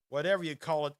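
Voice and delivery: usual speaking voice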